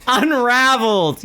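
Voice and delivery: slow laughing guess. Real, not acted. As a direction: laugh it out, drawn out.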